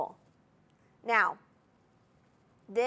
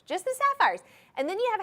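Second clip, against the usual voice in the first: jumps up high and louder on sapphires; excited; 9 semitones higher, 5 dB louder, faster than usual. Real, not acted. Host thrilled by sapphires for shoppers, high excited burst. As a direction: gush over the sapphires. Host marvels at the stone count.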